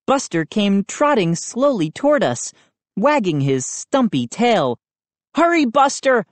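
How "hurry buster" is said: higher, urgent plea to the dog; pleading. A narrator's reading. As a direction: beg the dog to hurry. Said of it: "I pleaded".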